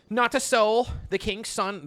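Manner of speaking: hammed-up Shakespeare reading; theatrical reading. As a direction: launch into the line with flair. Reading Ariel's lines.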